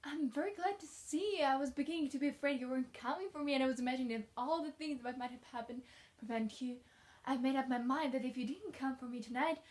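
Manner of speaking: put-on lively child character, emphatic and bubbly; eager chatty child. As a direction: pour it out like an eager orphan child. Reads eager orphan girl's lines.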